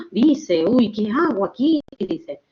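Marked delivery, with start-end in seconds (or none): 0.7-1.8 gasp, then a startled "uy"; lightly acted fright; scared little face. Teacher voicing a frightened face drawing, lightly scared. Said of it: "Una carita asustada".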